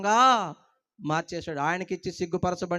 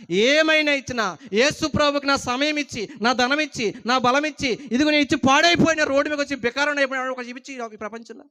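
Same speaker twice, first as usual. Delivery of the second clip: higher, louder preaching at full force; preaching shout; 6 semitones higher, 8 dB louder than usual. Real, not acted. Pastor challenging the congregation to name one ruined giver: higher, louder preaching shout. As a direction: hammer it out to the back row, higher and louder.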